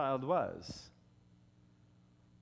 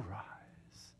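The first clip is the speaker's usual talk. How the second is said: whispered command; 6 semitones lower, 15 dB quieter than usual. Retold or imitated. Preacher voicing a command to a dead boy, whispered. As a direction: drop to a very soft whisper.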